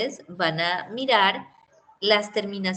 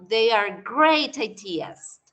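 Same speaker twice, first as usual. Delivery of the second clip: each word spaced out slowly for learners; slow clear model sentence; 4 dB louder, much slower than usual. Shown as a demonstration. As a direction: say it slowly so students follow. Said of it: "Yo puedo decir".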